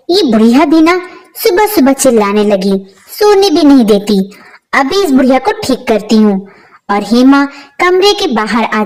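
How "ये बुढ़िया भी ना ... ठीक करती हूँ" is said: usual speaking voice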